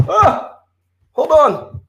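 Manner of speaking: sudden high startled yelp. Real, not acted. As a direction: jump at the crash, then catch yourself. I just knocked over my microphone.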